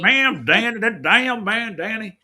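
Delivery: high, slow hillbilly drawl on "that damn Mamdani". Pretend. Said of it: "Let's say it like a, like a redneck".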